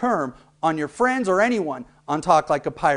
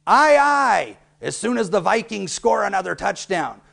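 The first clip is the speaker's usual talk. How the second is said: pirate voice; 2 semitones higher, 3 dB louder than usual. Shown as a demonstration. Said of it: "you might say".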